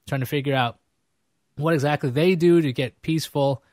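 ordinary talk, nothing marked

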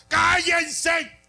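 thunderous shout, slowing at the end; Jesus shouting. Acted. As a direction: roar it over the mourners.

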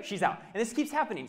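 normal talking voice

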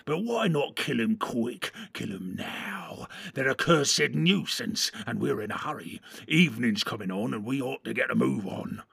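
lightly voiced orc urging a killing; a touch of orc temper; impatient orc.

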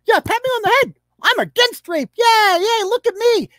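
very high, excited mocking squeal; hammed-up falsetto bragging, begging for praise; mock attention-seeker. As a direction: squeal for attention like a showy activist. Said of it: "Just one performative thing".

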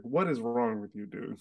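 ordinary talk

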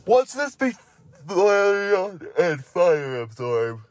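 high, drawn-out mock question; put-on silly voice. Reader hamming up the next Pokemon's name, silly high voice. Silly first take, then repeated normally.

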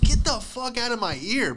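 high, wailing annoyed protest; mock irritation. Student to kid whispering about weed, whining in annoyance. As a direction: recoil from the whisper, pitch swooping high. Acted retort to whispering classmate.

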